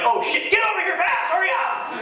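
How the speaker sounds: high, urgent hollering through laughter; scared, hurry them along; panicked shout. Retold or imitated. Dad scared of the crowd, hurrying his daughter, panicked shout. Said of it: "I got scared".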